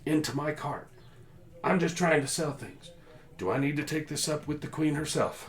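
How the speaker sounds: very high, loud, outraged shout; furious merchant. Acted. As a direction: yell right in the guard's face. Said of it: "he's screaming at the one".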